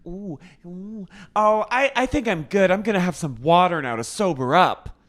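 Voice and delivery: high, prissy, careful voice; politely decline, sounding proper; prim Charlotte. Retold or imitated. Charlotte turning down wine, high prissy voice. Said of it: "She goes".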